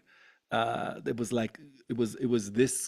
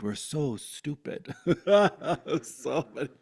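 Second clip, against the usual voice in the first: laughing through the words; 4 semitones higher, 5 dB louder than usual. Real, not acted. Laughing to the interviewer about humanity. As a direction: laugh it off, amused at us all.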